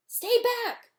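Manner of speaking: high, loud warning shout. Acted. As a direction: hold the monster off. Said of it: "Drake warned".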